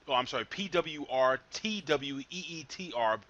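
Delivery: normal talking voice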